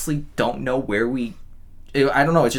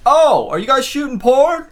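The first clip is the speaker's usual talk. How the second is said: high, slow, suggestive mock question; hammed-up curious guy; nosy neighbor; 14 semitones higher, 7 dB louder, slower than usual. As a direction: stretch it out high like a leering neighbor. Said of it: "he goes".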